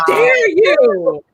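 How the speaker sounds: mock outrage. Mock outrage, louder retake.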